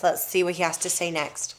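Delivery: ordinary delivery, nothing marked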